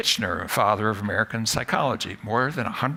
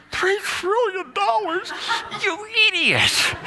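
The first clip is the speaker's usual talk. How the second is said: much higher, slow mock outrage at himself; mock self-scolding; 3 dB louder, slower than usual. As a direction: scold yourself in a high shocked voice.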